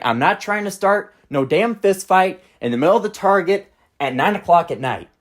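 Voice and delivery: ordinary talk, nothing marked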